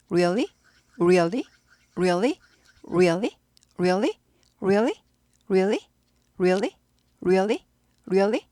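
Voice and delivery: "Really" with a quick "wri" onset, rising; short, evenly repeated "Really" with rounded lips on the R; rounded English R. Shown as a demonstration. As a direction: drill "Really" low, ending on a rise each time. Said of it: "우리를 한 박자에 빨리 하는 거죠".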